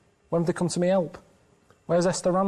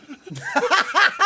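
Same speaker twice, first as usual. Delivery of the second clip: bursting into a high laugh; loud laugh; 5 dB louder than usual. Real, not acted. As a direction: let a big laugh escape. Laughing at own story.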